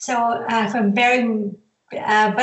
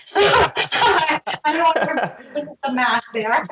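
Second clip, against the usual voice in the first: real laugh bubbling through, higher pitch; amused, higher, laughing as you talk; 5 semitones higher, slower than usual. Real, not acted. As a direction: laugh through the words at your own joke. Joking about age, laughing.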